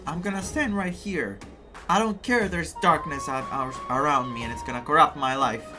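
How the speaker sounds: usual speaking voice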